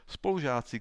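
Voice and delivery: ordinary delivery, nothing marked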